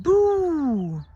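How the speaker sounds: loud, high ghostly boo. A narrator's reading. Ghost scaring Skeleton, a loud high boo. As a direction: jump out with a big boo. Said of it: "Ghost made a face again and said".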